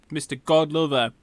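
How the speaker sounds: usual speaking voice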